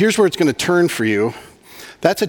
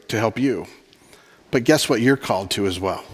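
ordinary delivery

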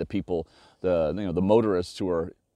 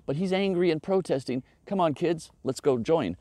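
sarcastic, pitched-up cheerful quote; mock enthusiastic bystander; 8 semitones higher than usual. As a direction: lampoon a naive onlooker joining in. Mocking an imagined onlooker.

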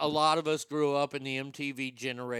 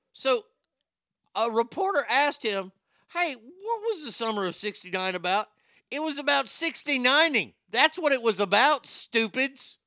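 hammed-up radio bit voice; mock explainer; 9 semitones higher, 8 dB louder than usual. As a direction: announce it big, pitched well up, for shock value.

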